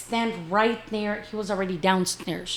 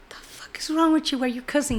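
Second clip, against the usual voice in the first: higher-pitched retort, taken aback; indignant comeback; 6 semitones higher, faster than usual. Retold or imitated. Mother challenges her son over his cussing, indignant. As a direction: throw it back as a startled challenge.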